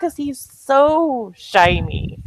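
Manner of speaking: high, loud, excited astonishment; giddy and loud, stretching the last words; amazed gushing. Real, not acted. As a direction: gush in disbelief, pitch climbing. Gushing over the athlete's oiled shine.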